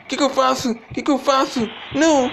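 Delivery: high frantic panic; panicking. Real, not acted. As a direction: cry out in fear. Chased by monster, panicking.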